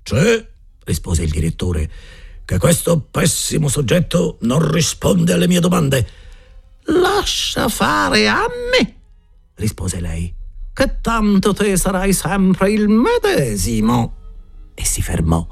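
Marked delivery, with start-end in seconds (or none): 0.0-6.2 headmaster complaining. A narrator's reading. Headmaster answers wife. 6.9-14.1 very high, slow scornful woman's voice; scornful headmistress. A narrator's reading.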